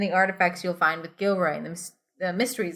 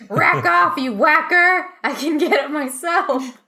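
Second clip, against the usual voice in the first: put-on loud, high angry bark in a heavy foreign accent; shouted furiously by a rude stranger with a heavy accent; 8 semitones higher, 7 dB louder, slower than usual. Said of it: "He barks in a heavy accent".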